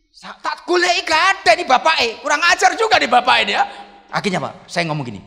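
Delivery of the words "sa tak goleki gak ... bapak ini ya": high, loud comic indignation; mock scolding. Pastor looking for the patient's father, mock outrage. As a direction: rail at an absent culprit, half joking.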